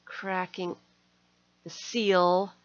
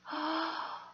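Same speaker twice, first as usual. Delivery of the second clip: gasp; 8 semitones higher, 6 dB quieter than usual. Real, not acted. Sees gift.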